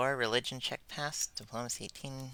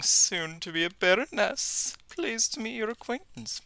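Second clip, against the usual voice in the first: lightly voiced noble; 10 semitones higher, 4 dB louder than usual. Reading a noble NPC's greeting.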